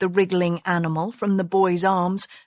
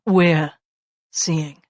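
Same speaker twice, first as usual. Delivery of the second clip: "We're" hit louder, then "seeing" falls away; emphasis on we're; 4 semitones lower, 3 dB louder than usual. Acted. Husband corrects his wife's you're, stressing We're. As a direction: lean hard on the word We're. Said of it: "he said with emphasis".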